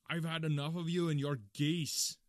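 bellowing angrily at a pest; angry shout. Acted. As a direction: shout it in exasperation. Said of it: "he shouted".

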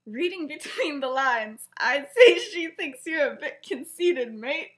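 performing a favorite punchline with relish; retold teasing reply. Sirius's acted teasing reply.

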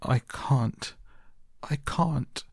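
high-pitched helpless wail, lightly acted; gasped sobbing. A narrator's reading. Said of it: "he gasped and sobbed".

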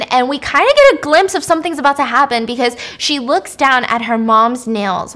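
ordinary delivery

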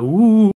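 drawn-out rising mock-eerie "woo"; playful cliffhanger sound; spooky woo. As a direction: make a teasing ghostly ooh. Imitates spooky cliffhanger sting.

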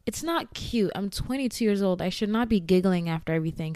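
ordinary delivery

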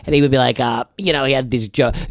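normal talking voice

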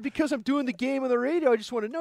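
high-pitched, retold self-justification; defensive explanation. Retold defensive reply to angry coach.

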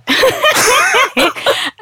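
high, helpless laugh; long high-pitched laughing fit; real burst of laughter. Real, not acted. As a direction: crack up at the joke. Cracks up at co-host's name guess.

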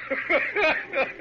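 a short high burst of laughter; amused laugh. Acted. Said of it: "It's hardly a laughing matter".

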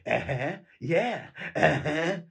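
menacing calm through a grin; quiet, unsettling smiling drawl; Nicholson smile. Retold or imitated. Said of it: "smiling at him like Nicholson".

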